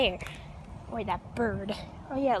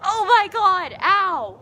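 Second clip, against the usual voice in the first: loud, raised cry of pain; pained yelp; 6 semitones higher, 8 dB louder, slower than usual. Real, not acted. Inflexible climber hurting on the fence, yelping.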